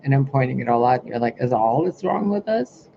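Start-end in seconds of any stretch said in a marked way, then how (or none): 1.4-2.9 mock question climbing high; imitated questioning. Speaker mimics those she is pointing it out to, mock questioning. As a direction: put on their questioning voice, higher. Voicing the listeners' questioning reply.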